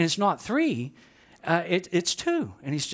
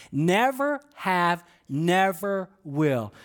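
very slow, drawn-out vow of refusal; emphatic refusal; much slower than usual. Retold or imitated. Preacher paraphrases Peter refusing the Lord, slow emphatic refusal. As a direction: stretch each word, utterly adamant.

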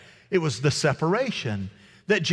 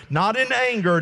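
normal talking voice

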